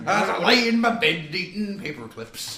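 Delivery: loud, high, slow hammed-up yokel; West Country accent. Friends riffing, loud, high comic yokel voice. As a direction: recite it in a daft rural drawl. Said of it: "where my fucking accent's coming from".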